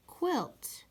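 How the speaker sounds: quilt with a crisp kw start, pitched high; teacher modelling quilt; sound demo. Shown as a demonstration. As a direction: model the word quilt clearly. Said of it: "this is a".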